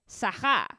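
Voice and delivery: name with French R, stressed on the last syllable; loud imitated French pronunciation; French accent. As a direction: say it like a French speaker. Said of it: "or French goes".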